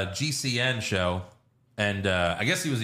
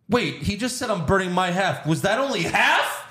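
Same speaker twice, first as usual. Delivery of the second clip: high, rising incredulous question; confused disbelief; 9 semitones higher than usual. Retold or imitated. Viewer reacting on first seeing the money burn, high and incredulous. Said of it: "I was like".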